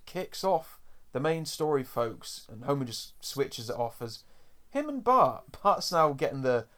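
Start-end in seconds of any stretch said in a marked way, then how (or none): none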